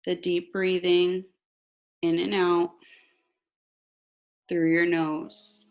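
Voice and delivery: slow, calm meditation voice; guided meditation. Acted. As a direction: guide them gently into relaxation.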